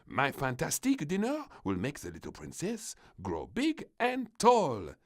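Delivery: lightly voiced French chef. A narrator's reading. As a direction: proudly announce the dinner in mild French accent. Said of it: "announced the chef".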